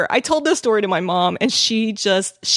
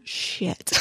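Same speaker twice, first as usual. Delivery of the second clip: whispered curse; 12 dB quieter than usual. Retold or imitated. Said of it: "I was like".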